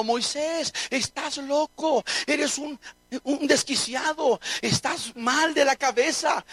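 ordinary talk

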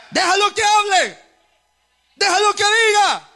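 preacher's ringing mock command; acted defiant shout. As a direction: call it out boldly as the king ordering his men. Voices David's defiant command.

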